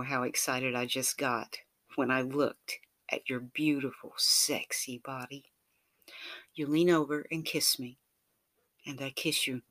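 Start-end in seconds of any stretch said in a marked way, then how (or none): none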